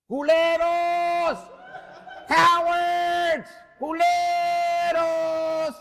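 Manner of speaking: slow, drawn-out, high jeering shout; mock the fleeing thugs; laughing taunt. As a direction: Fausto jeering the running thugs. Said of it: "Fausto says".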